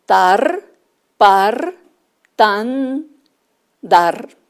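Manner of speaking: tar, par, tan, dar each loud and separate; clear syllables. Shown as a demonstration. Teacher presenting syllables to children, loud and clear. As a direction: call out each syllable firmly. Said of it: "¿cuál es diferente?".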